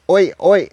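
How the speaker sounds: "Oi, oi" called high and loud, each one syllable; local greeting. Shown as a demonstration. Showing how locals say hello, "Oi, oi" called out high and loud. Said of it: "they say".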